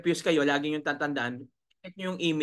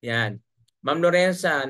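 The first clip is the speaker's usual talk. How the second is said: ordinary talk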